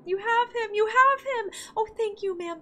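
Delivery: put-on a child's high, grateful voice fading to sleepy; grateful child ghost. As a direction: play a small ghost child thanking its mother. Voicing the child ghost's thanks.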